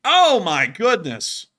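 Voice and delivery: excited. Real, not acted. Watching tanks get hit.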